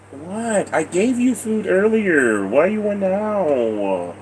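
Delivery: soft pet talk. Real, not acted. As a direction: gently scold the begging animal. Talking softly to a begging pet.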